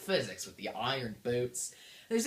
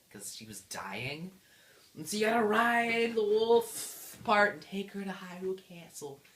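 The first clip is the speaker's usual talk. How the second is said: usual speaking voice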